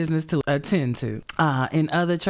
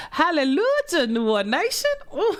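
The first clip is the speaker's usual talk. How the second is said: joyful hallelujah; 12 semitones higher than usual. Real, not acted. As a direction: shout it like praising in church. Celebrating filled monetization bar.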